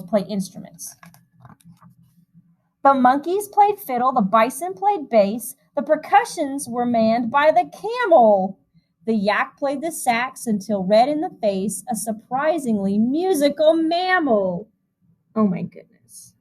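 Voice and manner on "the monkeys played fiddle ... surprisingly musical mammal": lilting storybook reading voice; rhythmic, gentle picture-book narration; reading to children. A narrator's reading. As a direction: read the rhyme aloud to a room of kids. Reads a rhyming picture book.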